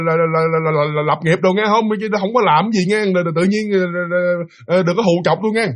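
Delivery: nervous placating stutter, tripping over words; sweet-talking stammer. Retold or imitated. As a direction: imitate a rattled man pleading sweetly. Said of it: "chuyển giọng liền, nói ngọt".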